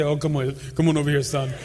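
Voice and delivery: low, easy fatherly God voice; deep voice of God. Acted. Preacher imagines God answering his prayer, in a deep voice. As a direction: play God calling a son over, deep-voiced. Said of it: "I imagine God with a deep voice".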